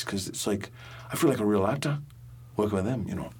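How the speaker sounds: put-on a light Geordie accent held through the story. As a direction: tell the tale in a mild Geordie lilt. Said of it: "can you now just, like, riff in that dialect".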